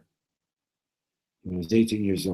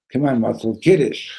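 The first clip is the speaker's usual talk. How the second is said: loud, higher hurry-up; impatient urging; 5 semitones higher, 11 dB louder than usual. Retold or imitated. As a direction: push the friend to get going. Friend urges again.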